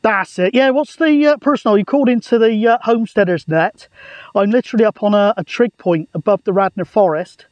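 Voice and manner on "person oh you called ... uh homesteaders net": ordinary delivery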